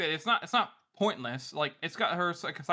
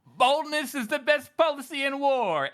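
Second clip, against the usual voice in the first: higher, slow, hammed-up war leader; mock brave commander; 9 semitones higher, 4 dB louder, slower than usual. Podcaster reading out a political meme, mock-brave proclamation. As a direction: proclaim it like a pompous defence chief. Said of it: "He goes".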